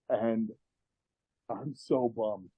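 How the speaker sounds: soft, slow disappointment; deflated. Retold or imitated. Apprentice realizes his abusive boss will live, quiet letdown. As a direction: let it sag, quiet and drawn out.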